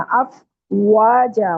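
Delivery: अफवाजा very slowly, waw as a rounded W. Shown as a demonstration. Teacher models recitation, slow rounded waw. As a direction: stretch अफवाजा, rounding lips on the W. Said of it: "होंठों को गोल करके".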